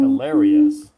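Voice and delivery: loud, high, excited announcement. Real, not acted. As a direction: present the word with a big burst of energy. Host announces word of the day.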